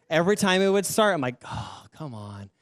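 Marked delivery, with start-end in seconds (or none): 1.4-2.5 slow, low groan under the breath; exasperated. Retold or imitated. Student fed up whenever it would start, exasperated. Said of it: "I was just so mad".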